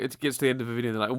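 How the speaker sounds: ordinary talk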